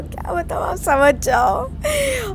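whispered words dissolving into giggles, stretched out slowly; can barely speak for laughing; real laughter swallowing the words; breathless laughter. Real, not acted. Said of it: "यार इतनी हंसी आ रही थी ना".

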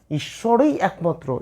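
ঈশ্বরই leaned on, slow and weighted; stress on ঈশ্বরই. Shown as a demonstration. Teacher showing students the emphasis, ঈশ্বরই stressed slowly. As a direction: press hard on the ই of ঈশ্বরই, slowly. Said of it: "ঈশ্বরই শব্দটার মধ্যে জোর দিয়েছে".

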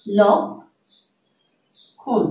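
লক্ষণ stretched out very slowly, each sound held; slow demonstration. Shown as a demonstration. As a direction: draw out লক্ষণ syllable by syllable. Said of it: "আমি দেখাচ্ছি". (লক্ষণ খুব ধীরে টেনে টেনে উচ্চারণ)

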